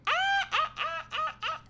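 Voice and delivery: high burst of laughter; laughing. Real, not acted. Laughing mid-rant.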